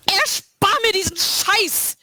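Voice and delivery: high, slow angry cry; furious outburst. A narrator's reading. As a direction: spit it out in fury. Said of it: "schrie sie".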